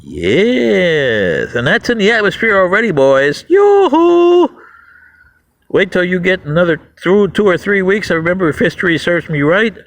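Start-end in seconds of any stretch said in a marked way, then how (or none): 3.5-4.5 taunting call. Acted.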